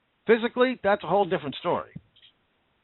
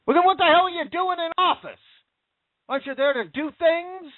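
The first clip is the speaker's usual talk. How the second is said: angry outburst; 9 semitones higher than usual. Real, not acted. Radio host to the governor, exasperated and indignant. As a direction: pitch jumps up high in real exasperation.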